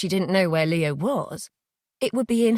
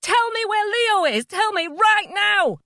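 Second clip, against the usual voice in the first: high loud slow yell; 14 semitones higher, 4 dB louder, slower than usual. Acted. Mother yelling at Jay to tell her where Leo is. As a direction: yell it with everything. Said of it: "she yelled at him".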